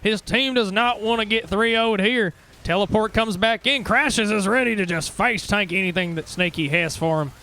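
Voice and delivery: live esports casting, rising with the action; play-by-play. Acted. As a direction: call the fight as it happens. Casting the final fight live.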